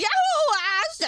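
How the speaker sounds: high shrieking whoop through laughter; wild cry. Real, not acted. Streamer whooping madly after her rage, high cry. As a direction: let out a crazed whoop.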